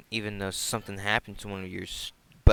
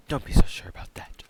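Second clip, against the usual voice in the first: ominous whisper; 3 semitones higher, 9 dB louder than usual. Acted.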